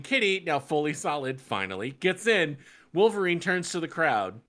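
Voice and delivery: normal talking voice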